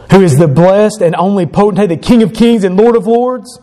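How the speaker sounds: a solemn, rising proclamation; scripture recitation. Real, not acted. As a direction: proclaim the verse with reverence.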